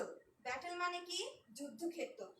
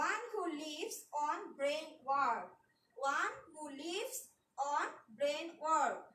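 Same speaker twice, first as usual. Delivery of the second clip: slow dictation; slower than usual. Shown as a demonstration. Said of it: "dictating a definition slowly".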